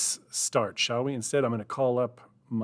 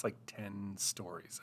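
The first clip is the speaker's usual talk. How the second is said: ordinary delivery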